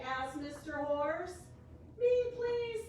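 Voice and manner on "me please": high, louder little cat voice; a high eager cat begging for a ride; cat's voice. A narrator's reading. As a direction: squeak it out like an eager kitten. Teacher voicing the cat.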